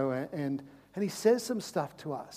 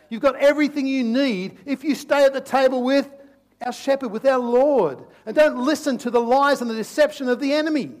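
loud, higher passionate preaching; preaching at full force; 8 semitones higher, 10 dB louder than usual. Real, not acted. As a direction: proclaim victory to the congregation. Preaching climax.

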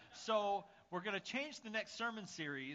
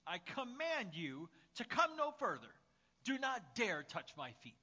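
slow, loud royal decree; theatrical, forceful command; commanding cry; 3 semitones higher, 5 dB louder than usual. Acted. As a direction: order the waves with full authority. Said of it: "cried Canute".

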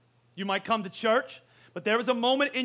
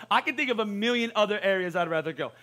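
ordinary talk, nothing marked

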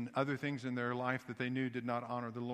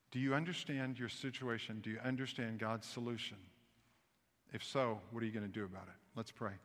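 softer, earnest altar call; quiet closing appeal; 3 semitones lower, 6 dB quieter than usual. Acted. Preacher closing his sermon to the congregation, softly earnest. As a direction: lower the voice and draw them in.

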